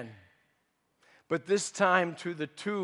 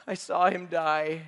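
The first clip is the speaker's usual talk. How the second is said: usual speaking voice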